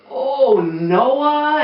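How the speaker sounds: ordinary delivery, nothing marked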